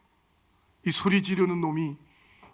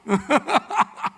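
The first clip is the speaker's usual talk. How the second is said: quick rising chuckle; short laugh; 5 semitones higher than usual. Real, not acted. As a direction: laugh at his own joke. Preacher laughs at own joke. (짧게 웃음)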